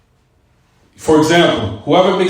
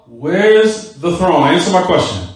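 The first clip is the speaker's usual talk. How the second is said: very slow, stretched "Where's the throne"; insistent repetition. Acted. Teacher cutting off an opponent's dodge, slowly insistent. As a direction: draw out each word, refusing to be sidetracked. Insistent questioner in acted debate.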